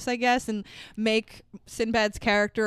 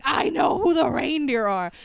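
frantic shout; 3 semitones higher than usual. Retold or imitated. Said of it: "He's, like, losing his shit".

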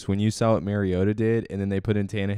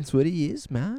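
surfer dude voice; 8 semitones higher than usual. Pretend. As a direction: play it like a stoned surfer.

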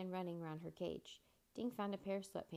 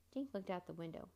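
ordinary delivery

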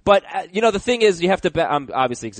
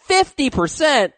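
high, drawn-out whine; whiny voice; 13 semitones higher, 4 dB louder, slower than usual. Retold or imitated. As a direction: whine it in disbelief. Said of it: "in my whiny voice".

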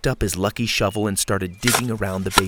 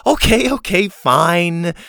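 high, loud showy giving-in; mock reluctance; 8 semitones higher, 7 dB louder, slower than usual. A narrator's reading. Girl pretending the class begged an encore, loud mock reluctance. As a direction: give in grandly as if begged. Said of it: "as if being begged to go back up".

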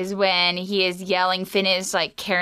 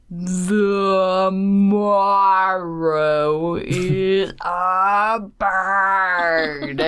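very slow, loud, strained cartoon drawl; Jake voice; 4 dB louder, much slower than usual. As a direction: stretch it out like it hurts. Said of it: "this is Jake".

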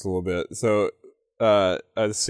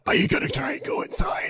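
raspy whispered bat voice; breathy high bat whisper; 9 semitones higher, 5 dB quieter than usual. Acted. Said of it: "she's also getting a bat voice".

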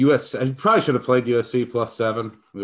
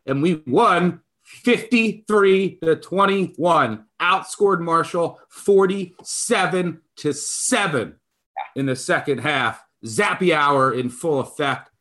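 excited; 9 semitones higher than usual. Real, not acted. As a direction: celebrate like a hyped fan. Excited fan cheering.

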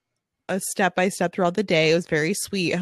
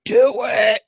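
put-on louder bossy little girl demand; bossy toddler; 4 semitones higher, 6 dB louder than usual. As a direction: order it like a stubborn three-year-old. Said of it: "And she's like".

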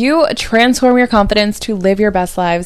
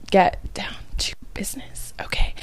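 playful whisper; 11 dB quieter than usual. Pretend. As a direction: whisper it, unvoiced. Mock-secret getting down to business.

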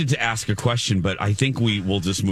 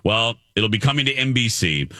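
ordinary talk

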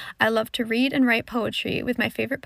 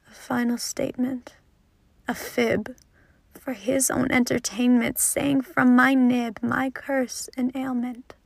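dramatic spoken-word delivery, loud peaks; poem recitation; 4 dB louder than usual. Acted. Teen poet performs her own poem for the podcast, impassioned recitation. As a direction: perform it, hitting the angry lines hard.

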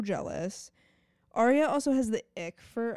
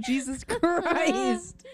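stunned; 9 semitones higher, 5 dB louder, much slower than usual. Real, not acted. Reacting to vampire voice.

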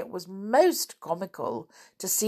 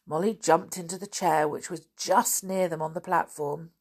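usual speaking voice

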